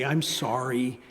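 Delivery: hushed, whispered little apology; a mild hint of tearful child; a child who comes back crying to apologize; soft child's apology. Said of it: "come back crying and say".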